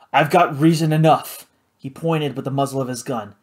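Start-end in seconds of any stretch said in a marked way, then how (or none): 0.1-1.5 loud, hard-edged reply; gruff angry judge. Acted. The judge to Karina, angry and loud. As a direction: throw it back at her.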